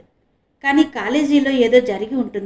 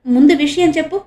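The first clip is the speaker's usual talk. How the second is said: eager demand, higher and louder; eager asking; 6 semitones higher, 8 dB louder than usual. A narrator's reading. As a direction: press urgently for the news. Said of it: "ఆత్రంగా అడుగుతూ".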